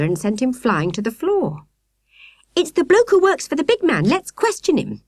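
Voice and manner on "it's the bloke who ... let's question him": put-on high, loud eager girl's cry; excited cry. A narrator's reading. Victoria crying out as they pin the stranger, excited. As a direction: blurt it out, thrilled to catch him. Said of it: "Victoria cried".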